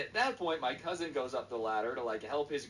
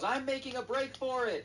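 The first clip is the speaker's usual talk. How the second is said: put-on high-pitched triumphant kid cry; hammed-up, excited high shout; mock escape cry; 13 semitones higher than usual. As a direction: act out a kid bolting with the prize.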